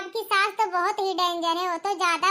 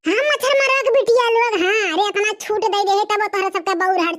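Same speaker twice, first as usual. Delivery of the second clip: put-on louder, lamenting old mother-in-law; scolding mother-in-law; 3 semitones higher, 8 dB louder than usual. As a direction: wail and scold like a shocked village mother-in-law. (बहुओं को डाँटती सास, ऊँची आवाज़ में विलाप)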